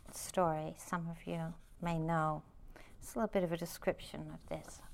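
ordinary talk, nothing marked

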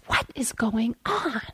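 hushed, breathy whisper of "What is going on?"; whispered frustration. Retold or imitated. Teacher recalling her inner reaction, whispered. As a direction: let the inner friction out under your breath. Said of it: "Oh, the friction in that moment of just".

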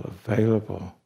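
ordinary delivery

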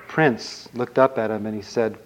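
ordinary delivery, nothing marked

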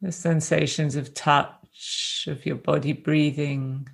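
gentle, unhurried meditation guidance with long silences between phrases; guided meditation. Real, not acted. Teacher guiding a group to rest in the body, calm meditation voice. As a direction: ease them into stillness, leaving space after each phrase.

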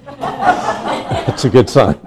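slow, amused laugh breaking into speech. Real, not acted. Teacher pleased the crowd laughed at his joke, laughing. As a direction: chuckle through the words, drawn out. Chuckles at own audience's reaction.

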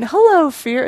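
mock holler, part-way to a shout; top of lungs. Father and son run downhill yelling, retold holler. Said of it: "at the top of their lungs".

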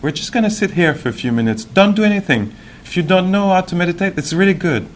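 high, light, quick imitation of a teacher; playful high-pitched teacher impression; imitating meditation teacher. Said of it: "she was giving instruction for meditation".

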